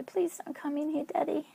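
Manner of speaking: put-on high, pleading little girl's voice; frightened little girl. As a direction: plead like a scared small child. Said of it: "I would regress and I'd say".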